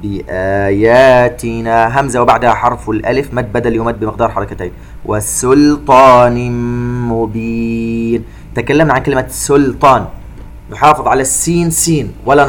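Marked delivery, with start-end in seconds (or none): 0.0-2.0 slow chanted tajweed recitation with echoing qāf; Quran recitation. Shown as a demonstration. 5.0-8.2 slow chanted tajweed recitation with echoing qāf; Quran recitation. Shown as a demonstration.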